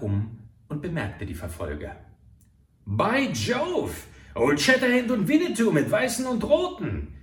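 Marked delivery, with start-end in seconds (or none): 2.8-7.1 high and loud, startled; fleeing murderer crying out in alarm; alarmed exclamation. A narrator's reading. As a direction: blurt it out on spotting the pursuers. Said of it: "rief er aus".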